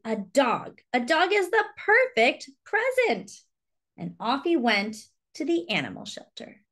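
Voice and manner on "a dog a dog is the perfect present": rising high with a sudden idea; light up at the discovery; excited Charlie. Acted. Charlie realizes a dog is the present, excited. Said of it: "said Charlie".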